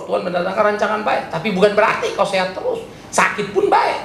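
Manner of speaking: ordinary talk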